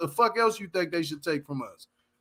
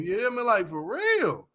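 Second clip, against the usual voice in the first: usual speaking voice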